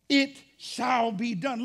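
"It shall be done" stretched, with a long "It"; firm declaration. Shown as a demonstration. Preacher sealing his point, "It shall be done" slow and certain. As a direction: hold "It", then weigh out "shall be done".

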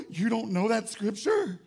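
hammed-up scandalised church member; mock shock.

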